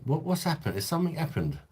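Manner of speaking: high-pitched, puzzled questions; what on earth has happened; baffled questioning. Real, not acted. Streamer baffled by upset in chat.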